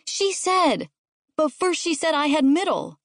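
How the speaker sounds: put-on high-pitched kid arguing; squabbling sibling's voice; indignant child. A narrator's reading. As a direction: insist on it like a wronged child. Child arguing over seats.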